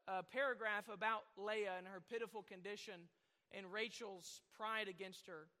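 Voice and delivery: usual speaking voice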